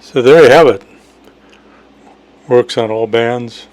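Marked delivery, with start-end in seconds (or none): none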